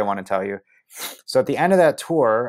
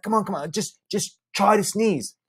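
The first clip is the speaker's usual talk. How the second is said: coaxing friend; 10 semitones higher than usual. Retold or imitated. Brian coaxing.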